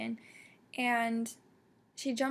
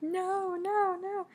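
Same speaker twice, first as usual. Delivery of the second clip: high, rising, louder cries of no; anguished no; 8 semitones higher, 3 dB louder, slower than usual. Retold or imitated. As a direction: plead with the page as dread builds. Retold dread as they fight.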